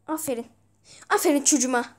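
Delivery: loud teasing praise of the player; mock praise catchphrase. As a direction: cheer him on like a proud mocking parent. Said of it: "Benim lafım kimse kullanmasın". (yüksek sesle alaycı aferin)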